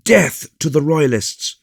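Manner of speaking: high, loud battle cry; fervent rebel shout; excited cry. A narrator's reading. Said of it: "cried the most excited of his hearers".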